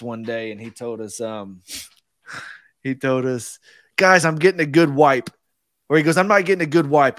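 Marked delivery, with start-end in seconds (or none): 4.0-5.3 "wipe" for vibe, with a W in place of the V. Retold or imitated. Annoyed Russian professor telling the class, "wipe" with W for V. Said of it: "he would pronounce his V's as W's". 6.2-7.2 "wipe" meant as vibe, starting with W; retold annoyed professor, loud and raised, saying "wipe" for vibe. Annoyed Russian professor correcting himself to class, "wipe" with W for V. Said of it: "he would pronounce his V's as W's".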